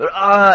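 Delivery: high, loud sudden cry; imitated night cry. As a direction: imitate someone crying out at night. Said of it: "we hear someone say".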